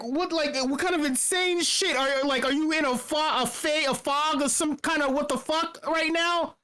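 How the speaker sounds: high, stumbling retold exasperation; baffled disbelief. Questioning the kid's mental fog, incredulous. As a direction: fumble for words in high-pitched bafflement.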